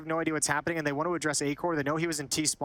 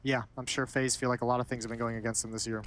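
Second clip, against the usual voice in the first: low, dry sarcasm; sarcastic; 5 semitones lower than usual. Real, not acted. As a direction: agree in a lowered, deadpan sarcastic voice. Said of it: "Yeah, it's sarcasm".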